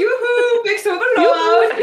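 high, loud mock Nordic yoo-hoo accent; funny accent. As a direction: call out in a silly sing-song accent, half laughing. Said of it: "guy from Frozen who has the funny accent".

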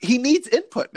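playfully insist, pitch jumping up; mock urgency. Quoting the robot's catchphrase.

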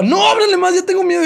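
high, laughing imitation of a frightened girl pleading; mock scared girl. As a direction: whine it up high, hamming her fear. Girl pleading, voiced by narrator. (imitación aguda y burlona de chica asustada)

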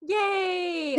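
high, bright shout of joy; cheer. Real, not acted. Cheering friend's plushie story.

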